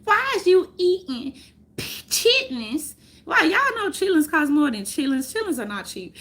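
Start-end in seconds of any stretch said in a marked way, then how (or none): 0.0-3.0 higher, louder, slow mock whine; teasing a condescending voice; mocking condescension. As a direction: ham up a snooty critic.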